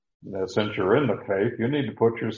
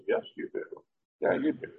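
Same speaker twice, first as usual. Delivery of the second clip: whispered answer; 10 semitones higher, 7 dB quieter, faster than usual. Pretend. Voicing the expected answer.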